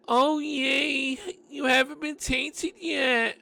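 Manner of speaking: put-on high, slow girlish voice; bright high young woman voice; girly voice. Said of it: "regular guy voice".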